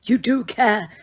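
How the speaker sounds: a calm, lightly voiced old wizard; Dumbledore, calm. A narrator's reading. Headmaster reassuring Harry, calm old wizard voice. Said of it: "said Dumbledore".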